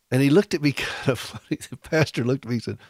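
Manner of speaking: plain reading voice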